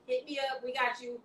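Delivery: ordinary delivery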